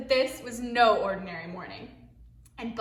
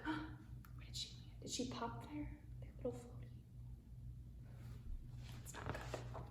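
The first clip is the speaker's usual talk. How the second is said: fast hushed whisper; hushed concern; worried whisper; 3 semitones higher, 19 dB quieter, faster than usual. Real, not acted.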